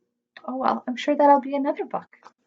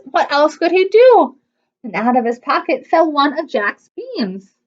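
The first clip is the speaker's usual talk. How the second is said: usual speaking voice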